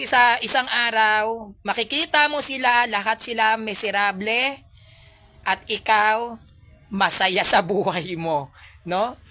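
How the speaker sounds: usual speaking voice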